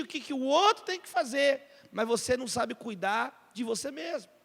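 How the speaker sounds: ordinary talk, nothing marked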